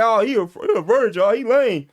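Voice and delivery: mock the kid like his teammates would; teasing teenage boys. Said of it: "I hear them talking to each other, getting on each other".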